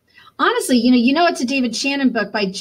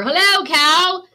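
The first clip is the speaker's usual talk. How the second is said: high, loud, slow sing-song hello; storybook duck calling hello; cheery duck greeting; 9 semitones higher, 6 dB louder, slower than usual. Acted. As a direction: greet the cow like a proud duck. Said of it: "said Duck".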